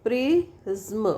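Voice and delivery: "प्रिज्म" stretched out, pri then zm; syllable by syllable. Shown as a demonstration. Teacher asking students to look, syllable-by-syllable word. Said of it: "अब देखो, प्रे".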